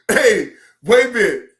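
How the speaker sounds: normal talking voice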